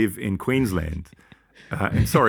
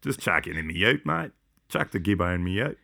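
mock Aussie yobbo. Mocking Queensland yobbo.

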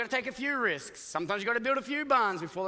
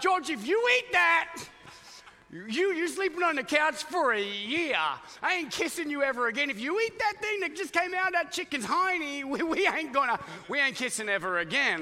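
scolding wife; 5 semitones higher than usual. Retold or imitated. George's wife threatening him over eating the egg. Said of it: "George's wife was like".

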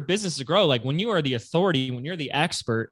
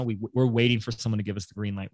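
ordinary talk, nothing marked

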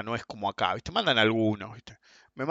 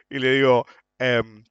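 ordinary talk

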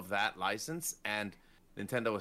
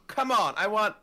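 higher, louder mock protest; acted exasperation; 8 semitones higher, 6 dB louder than usual. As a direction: plead like a fed-up customer. Fed-up customer in acted exchange.

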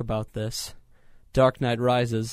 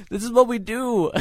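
put-on old woman voice; 8 semitones higher, 5 dB louder than usual. Still in the high voice, laughing.

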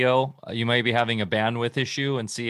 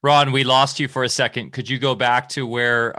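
raised voice; 4 semitones higher, 7 dB louder than usual. Real, not acted. Calling to a frozen caller.

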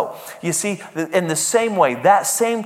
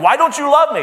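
higher-pitched, mock wife's challenge; confronting wife; 8 semitones higher, 4 dB louder, faster than usual. Wife confronting her husband in a tense moment, mock-confrontational. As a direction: act out a wife's complaint, pitched up. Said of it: "she's in confronting me".

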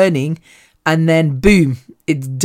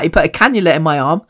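ordinary talk

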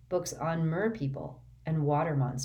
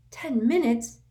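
croaked; 7 semitones higher, 5 dB louder than usual. A narrator's reading. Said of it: "Harry croaked".

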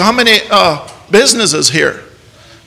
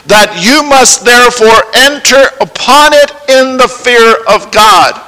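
rising, louder solemn reading of the vow; 4 semitones higher, 3 dB louder than usual. Acted. Preacher reading the vow to the congregation, solemn. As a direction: read the vow out like a rite. Reads marriage vow aloud.

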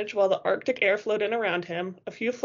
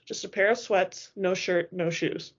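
ordinary delivery

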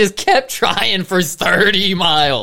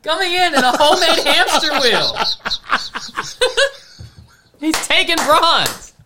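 higher, louder through giggles; high laughing voice; 12 semitones higher, 4 dB louder than usual. Real, not acted. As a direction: laugh it out at the silly image.

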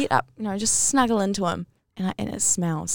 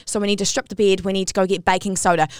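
panicked planning; 2 semitones lower, faster than usual. Retold or imitated. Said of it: "I'm going".

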